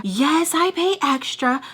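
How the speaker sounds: mock her offence, drawing out each word; super offended. Imitating her about paying extra, super offended. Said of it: "Like, super offended".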